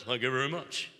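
low drawn-in impression of a famous singer. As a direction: drop low for a rock-and-roll thank-you. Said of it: "He talked like [name]".

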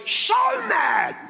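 hammed-up high cry of "so mad"; mock exasperated wife. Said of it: "And she goes".

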